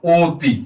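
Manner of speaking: অতি opening on a long rounded "o"; অতি with its first vowel held as ও; ও-sound demonstration. Shown as a demonstration. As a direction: say অতি as "oo-ti" for a learner. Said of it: "এটা উচ্চারণ আমরা লিখতে পারবো কি".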